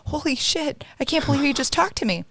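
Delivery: amazed disbelief. Retold or imitated. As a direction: say it high as if you can't believe it. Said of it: "I was like".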